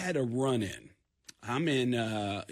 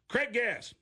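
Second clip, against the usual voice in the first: high-pitched, curt cop voice; dismissive officer; 6 semitones higher than usual. Retold or imitated. Officer reading the comedian's ID, dismissive. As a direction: imitate an officer unimpressed by a name.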